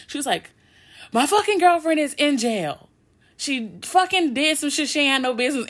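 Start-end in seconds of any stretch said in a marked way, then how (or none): 1.1-5.7 upset outburst. Retold or imitated. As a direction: spill it out in a rush of upset. Blurting out the news to mom.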